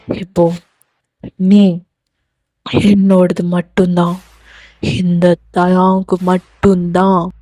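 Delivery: low, possessive male character voice; low-voiced angry lover, drawing out phrases; menacing obsessive lover. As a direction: press each phrase down low and threatening. Voices Dev threatening the heroine.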